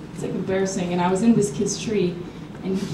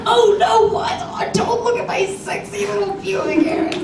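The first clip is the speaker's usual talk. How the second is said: mock coy protest; 11 semitones higher, slower than usual. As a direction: play coy and horrified, way up high. Same coy act, with twist.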